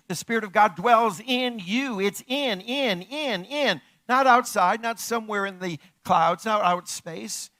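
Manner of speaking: loud, high preaching build; preacher hammering each word; full-force preaching. Acted. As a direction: drive the point home to the congregation.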